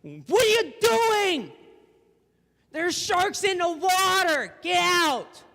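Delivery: high, loud, drawn-out shout; frantic acted yell of alarm; screaming warning. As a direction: scream across the water to someone overboard. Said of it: "kinda screaming out at them".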